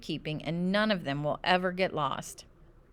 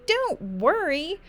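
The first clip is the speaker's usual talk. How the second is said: high, slow, exaggerated echo; high panicky retort; 10 semitones higher, 3 dB louder, slower than usual. A narrator's reading. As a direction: throw the words back, higher and drawn out. Child mocks dad's reassurance.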